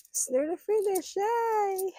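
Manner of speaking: high, loud, gleeful squeal; excited cheer. Real, not acted. Vlogger celebrating a nearly finished savings challenge, excited cheer.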